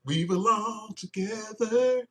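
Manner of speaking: slow, dreamy and pleading; dreamy lovesick plea, drawn out; lovesick Scorpio. Retold or imitated. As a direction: coo it like a smitten lover. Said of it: "Scorpio said".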